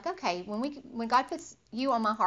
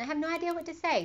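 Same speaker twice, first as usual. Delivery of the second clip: higher, fast, flustered; flustered inner voice; 7 semitones higher, faster than usual. Retold or imitated. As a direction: rush it out, at a loss. Voicing own worried inner thought.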